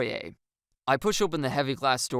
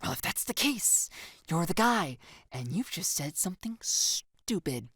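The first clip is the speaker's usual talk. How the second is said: mock pout; 6 semitones higher than usual. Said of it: "and pout".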